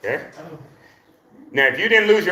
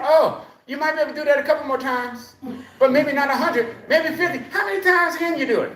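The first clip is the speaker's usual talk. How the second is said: legs complain to the exerciser, in a much higher voice; talking legs; 9 semitones higher than usual. Pretend. Coach speaks for the exerciser's aching legs, high playful voice. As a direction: voice tired legs bargaining, pitched well up. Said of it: "You should feel your legs talking to you".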